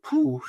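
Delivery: high, light phew of relief; a played-up sigh of relief; mock relief. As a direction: let the worry go in one breath. Relief at finally getting the attic.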